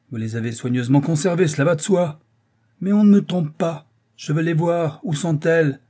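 angry interrogation. Acted. Father demanding the letters.

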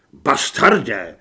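low, loud angry insult; enraged curse. Acted. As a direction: spit the insult in rage. (vzteklá hluboká nadávka)

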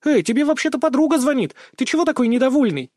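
high-pitched, perky protest; offended girl friend. A narrator's reading. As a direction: scold a grumpy friend in a high voice. Perky friend scolds grumpy man.

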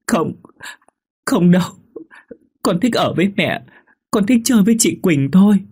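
put-on high, tearful child complaining; crying ghost child. Ghost child tells mother of cold, high and tearful. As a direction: whimper like a cold little child. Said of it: "khóc mà dỗi".

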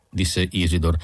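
plain reading voice

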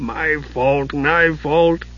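soft, broken moan of guilt; a dwarf moaning in despair. A narrator's reading. Dugan huddled, moaning his guilt.

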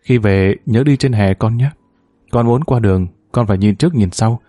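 ordinary delivery

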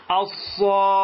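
الصاخة with the alif held for six counts before the doubled kha; الصاخة recited with a long necessary madd; long madd demo. Shown as a demonstration. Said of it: "الصاخة نفس الطريقة".

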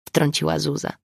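usual speaking voice

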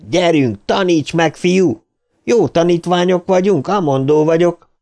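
high-pitched jeering challenge; taunting. A narrator's reading. Richie goads Viktor in the stone fight, high taunting.

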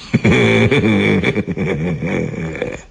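long, low creaky witch cackle; hoarse laugh. A narrator's reading. Baba Yaga gloats over supper, hoarse cackle. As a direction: rattle out a hoarse heh-heh-heh. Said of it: "засмеялась хрипло".

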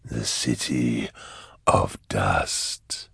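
deep, slow and drawn out; eerie demon voice. A narrator's reading. Demon voice answers Eisenhorn's question, ominous and slow. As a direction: stretch each word low and slow.

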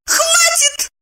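very high squeal of protest; shrieked. A narrator's reading. Evdokia cuts off Eva's boasting with a squeal. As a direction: squeal to stop it, pitch leaping. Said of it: "взвизгнула Евдокия".

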